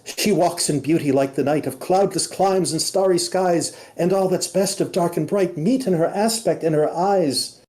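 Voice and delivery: high, lilting recited verse; butterfly reciting. Acted. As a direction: recite the poem like a fluttering spirit. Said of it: "he speaks".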